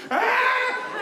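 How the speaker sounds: very high acted scream; mock yelp. Ninth grader under dad's foot, mock panicked yelp. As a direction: yelp like a pinned kid. Pinned kid cries out.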